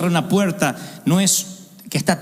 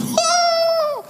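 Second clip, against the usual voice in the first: high exclaimed oh. Acted. Reaction shout.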